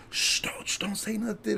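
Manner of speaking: hushed, high-pitched warning; soft but urgent, keep it secret; urgent shushing. Retold or imitated. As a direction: act out a friend frantically shushing. Friend shushes him beside the stepmom.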